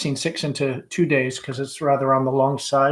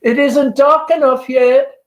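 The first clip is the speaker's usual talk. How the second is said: high, loud and slow, calling from another room; calling from offstage; 10 semitones higher, 7 dB louder, slower than usual. Acted. Amanda calling to Laura from offstage, high slow call. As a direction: sing it out to someone far away.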